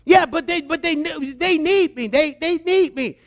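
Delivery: high, stammered mock protest; protesting mother. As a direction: imitate a mom objecting on behalf of her babies. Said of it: "And mothers, I know how you are".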